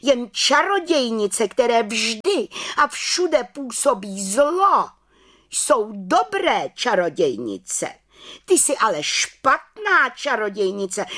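high, drawn-out head witch reprimand; scolding. A narrator's reading. Head witch lectures the little witch on evil, scolding. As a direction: lecture her sternly, pitched high and slow. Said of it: "hubovala vrchní čarodějnice".

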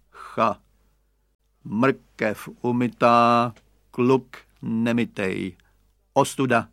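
slow, mocking hose voice; talking hose. A narrator's reading. Garden hose mocking the unwashed boy, slow and teasing. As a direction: drawl the tease slowly. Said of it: "hadice poznamenala".

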